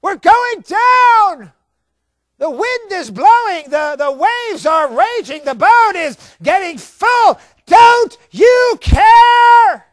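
high, loud, desperate shout; panicked outcry. Retold or imitated. Disciples shouting to Jesus in the sinking boat, desperate shout. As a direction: cry out over a raging storm.

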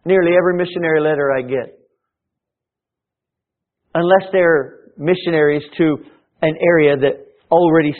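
loud driving sermon voice; fervent pulpit push; forceful preaching. Acted. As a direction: press the point hard to the congregation.

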